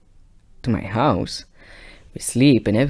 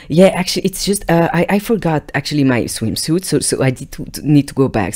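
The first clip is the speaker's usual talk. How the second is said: put-on girlfriend's excuse; 6 semitones higher, 7 dB louder than usual.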